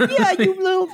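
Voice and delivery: high, sneering nasal mock voice with a laugh; Gary Vee impression. Mocking the rich guy to his co-host, nasal sneer. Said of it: "and he talks like this".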